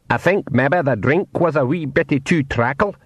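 small Scottish pixie voice. Acted. Pixie man speaks.

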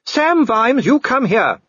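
very high, commanding wife's call; high, ringing imperious order; booming summons. A narrator's reading. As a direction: call a husband sternly down a corridor. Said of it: "Sybil's voice boomed along the corridor".

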